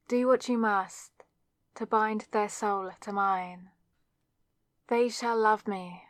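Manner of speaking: invoke the goddess with gravity; solemn incantation. Acted. Reciting the love spell incantation.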